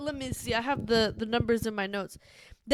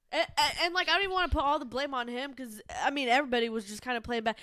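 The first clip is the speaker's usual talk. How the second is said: ordinary talk, nothing marked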